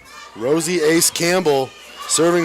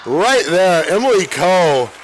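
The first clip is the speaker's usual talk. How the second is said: excited call; 4 semitones higher, 3 dB louder than usual. Real, not acted. Big play called live.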